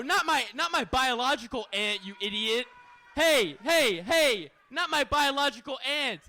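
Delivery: firm call-out to the audience; loud correction. Real, not acted. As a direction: shout them down, half joking. Correcting heckler loudly.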